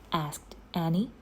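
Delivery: ordinary delivery, nothing marked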